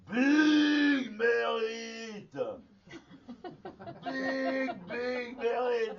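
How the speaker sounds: high, long-drawn mock bellow; imitated master's cry. Master bellowing at a disciple touching the kessa, imitated howl. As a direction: imitate a master howling, vowels stretched out. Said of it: "il a crié".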